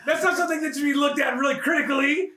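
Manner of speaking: drip with sarcasm while laughing; sarcastic defense of Arnold's character. Real, not acted. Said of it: "No, he doesn't have control issues".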